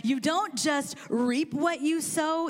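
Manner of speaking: ordinary talk, nothing marked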